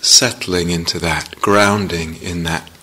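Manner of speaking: unhurried meditation guidance with long pauses; guided meditation. Real, not acted. Teacher settling meditators into the felt sense of the body, guided meditation. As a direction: ease them gently into practice, leaving space between phrases.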